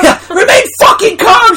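high mock yell. Friends quoting the panic scene, mock yell. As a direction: shout calm in panic.

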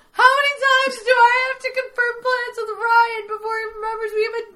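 loud, very high-pitched wail; hammed-up crying meltdown, wailing each word; sobbing. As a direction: imitate an overwrought sobbing girlfriend. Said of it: "like, sobbing".

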